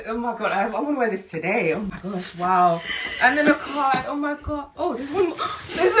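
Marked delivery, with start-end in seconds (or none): none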